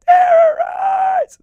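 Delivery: "Terrorize!" yelled very high, loud and slow; cartoon robot battle cry. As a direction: stretch out "Terrorize!" in a huge high shout. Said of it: "with that voice".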